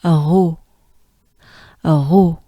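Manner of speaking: throaty French "r" into "ro". Shown as a demonstration. Teacher drilling the French r sound. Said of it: "You take a good breath".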